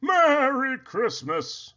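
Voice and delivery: high, drawn-out festive greeting; jolly Santa. A narrator's reading. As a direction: sing out the greeting.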